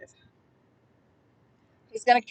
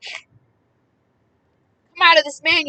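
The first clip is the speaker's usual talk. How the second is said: 1 commanding exorcism; 5 semitones higher, 6 dB louder, much slower than usual. Acted. Voicing Jesus casting out spirit.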